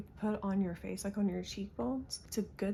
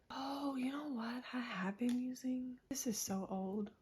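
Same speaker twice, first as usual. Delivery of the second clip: whispering; 5 semitones higher, 4 dB quieter than usual. Real, not acted. Whispering while baby sleeps.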